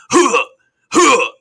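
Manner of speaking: sharp high effort grunts; loud jump grunts. Retold or imitated. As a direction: grunt it like a game hero jumping. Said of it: "He goes".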